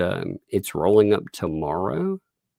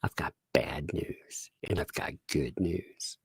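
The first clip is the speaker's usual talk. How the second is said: low, hushed and solemn; soft mock-serious announcement; 5 semitones lower, 12 dB quieter than usual. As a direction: imitate a child gravely breaking news. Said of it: "she was very serious too".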